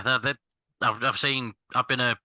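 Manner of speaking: nervous false starts and restarts; stammering. Real, not acted. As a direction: stumble over the opening words, restarting the sentence. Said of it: "sorry if I'm stuttering a bit".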